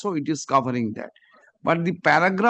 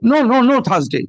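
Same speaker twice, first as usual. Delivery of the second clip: loud, raised-pitch objection; emphatic refusal; 5 semitones higher, 7 dB louder, faster than usual. Real, not acted. Judge rejecting counsel's request for Thursday, emphatic refusal. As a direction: shoot the request down loudly.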